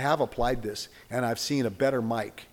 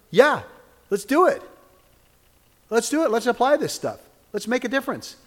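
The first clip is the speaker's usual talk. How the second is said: higher and louder, pumped-up call; rallying cry; 9 semitones higher, 5 dB louder than usual. Real, not acted. Preacher rallying congregation for the week, excited. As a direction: fire the crowd up.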